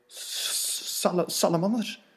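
whispered hiss on the S; hissing snake. Acted. Snake hissing at the mouse. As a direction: stretch the s like a snake.

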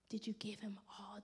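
soft unvoiced whisper. Real, not acted. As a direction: whisper it to their hearts. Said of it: "whispered sermon questions".

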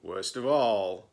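plain reading voice

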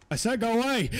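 high, loud order; loud command. Real, not acted. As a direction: yell at the ghost to leave. Insisting ghost leave.

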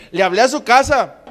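usual speaking voice

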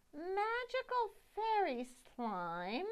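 plain reading voice

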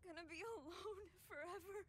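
put-on sobbing child ghost; crying.

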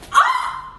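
high yelp when lifted; startled shriek. Real, not acted. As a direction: shriek as he lifts you. Lifted into air.

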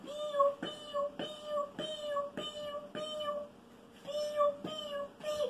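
tiny high, very soft cheeps; chick peeping. Shown as a demonstration. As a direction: peep softly up high like a baby chick. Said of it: "Vamos a buscar ahora el pollito". (piar agudo y suave de pollito)

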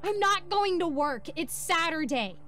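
high, slow, emphatic outburst; shrieked exasperation, drawn out; exasperated protest. A narrator's reading. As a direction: protest loudly, pitch way up. Wife protesting angrily at husband.

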